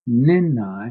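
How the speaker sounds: "Nenai" loud, with the opening "Ne" punched; emphatic pronunciation. Shown as a demonstration. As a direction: hit "Nenai" hard for the class. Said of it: "Emphasize on that".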